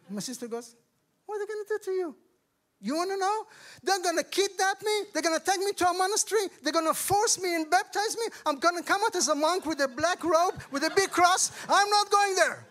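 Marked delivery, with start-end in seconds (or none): 1.3-2.2 high-pitched mock questioning; puzzled sister. Sister asking her brother about the retreat, puzzled and high-pitched. 2.8-12.7 high, loud comic rant; panicked refusal. Retold or imitated. Brother telling his sister he fears the Baptists, loud mock panic.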